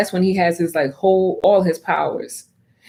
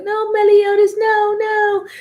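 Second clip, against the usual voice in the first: Elizabeth voice; 13 semitones higher, slower than usual. Retold or imitated. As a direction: beg him to stop in a high voice. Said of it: "Elizabeth is gonna be like".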